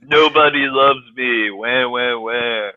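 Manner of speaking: slow crybaby wail, fading away; hammed-up self-pity, drawn out; mock whining. Host mocking Jon Snow, slow fake wailing.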